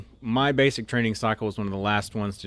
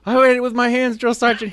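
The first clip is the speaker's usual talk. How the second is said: recruit sounding off; 14 semitones higher, 7 dB louder, faster than usual. Retold or imitated. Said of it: "And I was like".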